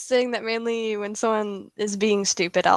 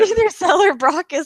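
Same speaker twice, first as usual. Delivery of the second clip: laughing as she says it; 8 semitones higher, 4 dB louder than usual. Real, not acted. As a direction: giggle through the names. Repeats accusation while laughing.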